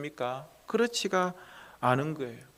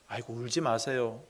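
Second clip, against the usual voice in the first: quiet, softer sighing "울지 마세요"; soft hollow comfort; 6 semitones lower, 9 dB quieter than usual. Retold or imitated. Preacher imitating an empty comfort to a mourner, softly. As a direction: murmur it as a hollow platitude.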